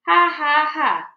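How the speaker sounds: loud hearty laughing; roaring laughter. Acted. The girl laughs at the fooled major, roaring laughter. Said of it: "roar of laughter".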